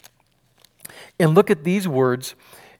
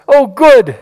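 much higher, louder mock cheer; sarcastic delight; 8 semitones higher, 10 dB louder than usual. Pharisees pretending to welcome sinners, hammed-up cheer. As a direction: fake a bright little cheer, then laugh.

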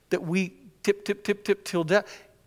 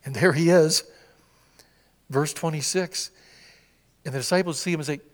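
ordinary talk

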